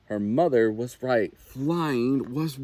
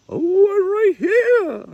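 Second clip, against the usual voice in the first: very high, loud, slow reassuring mother; mother owl; 10 dB louder, slower than usual. A narrator's reading. Mother owl answering Little Owl from overhead, high and reassuring. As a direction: answer her chick from above. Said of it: "said a familiar voice".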